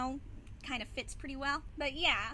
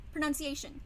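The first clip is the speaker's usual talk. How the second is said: ordinary talk, nothing marked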